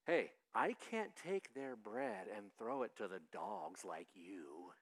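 low, slow brush-off; Jesus turning a woman away, low and slow; dismissive Jesus. Acted. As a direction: push her away with a low, cold line. Voicing Jesus dismissing her.

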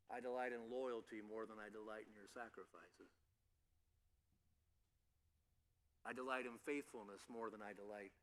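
soft, low voice saying "delight" like a heartfelt longing; hushed earnest plea. Acted. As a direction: speak God's words quietly and sincerely, almost to yourself. God's voice, pleading with people.

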